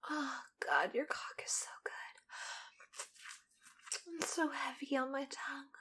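breathy sensual whisper. Acted. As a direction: whisper it close and intimate.

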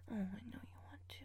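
soft fast whisper; teasing whisper. Acted. Teasing the partner about calling her mommy, a whisper. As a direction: whisper the tease close.

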